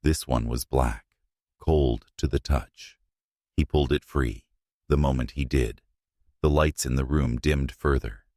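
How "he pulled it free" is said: usual speaking voice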